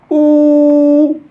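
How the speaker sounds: OU held long, high and loud; sound demo. Shown as a demonstration. Teacher shows how O U P sounds, loud high long OU. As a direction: belt out one sustained OU. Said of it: "on la prononce".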